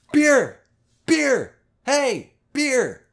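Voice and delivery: loud, high shouts for beer; imitated yelling. Imitating a guy shouting at the server for beer, loud holler. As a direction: bellow for a beer across the room. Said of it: "Just yelling at this person".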